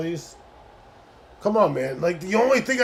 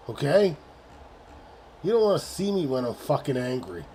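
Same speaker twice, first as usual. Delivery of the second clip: ordinary delivery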